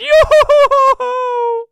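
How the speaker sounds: very high, loud, stretched-out whooping; slow goofy falsetto whoop. Pretend. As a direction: drag the whoop out slowly in a silly falsetto. Repeats the silly whoop.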